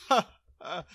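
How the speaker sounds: loud, mocking "ha, ha"; big put-on scornful laugh; acted guard laugh. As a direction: laugh right in the newcomer's face. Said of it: "He laughs and says".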